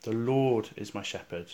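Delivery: "Lord" lengthened and leading, the rest falling; stress on The Lord. Shown as a demonstration. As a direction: lean on "Lord", then ease off. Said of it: "with the emphasis on the words".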